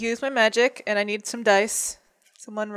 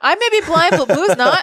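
defiant shout; 6 semitones higher, 5 dB louder than usual. Acted. Defiant retort in character.

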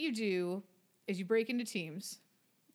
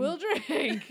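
laughing; 7 semitones higher, 6 dB louder than usual. Real, not acted. Laughing drinking game rule.

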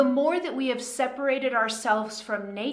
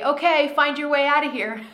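louder, higher playful order; mock command; 5 semitones higher, 7 dB louder than usual. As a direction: bark the order like a drill sergeant, half joking. Teacher voices her own command.

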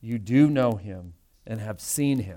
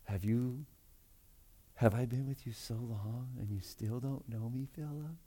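much softer, tired, let-down sigh of a line; weary exasperation; 2 semitones lower, 15 dB quieter than usual. Shown as a demonstration. Jesus to Philip, quietly exasperated. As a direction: sound worn out that he still doesn't get it. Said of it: "this is exasperation".

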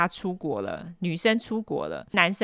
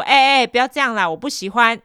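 high, loud flustered protest; loud pleading protest; retold protest; 4 semitones higher, 7 dB louder than usual. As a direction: push him off, fending off. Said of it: "我就说".

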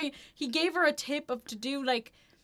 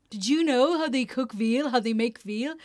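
lightly voiced teenage boy, growing louder; teenage boy impersonation; 9 dB louder than usual. Teenage boy chatting up girls about veal, lightly imitated. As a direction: imitate an awkward pickup line, getting louder. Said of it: "impersonation of a teenage boy".

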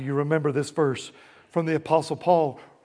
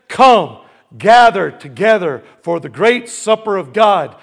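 loud, ringing scripture summons; loud calling out; 8 dB louder than usual. A narrator's reading. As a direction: call out loudly to summon all. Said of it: "called out in a loud voice".

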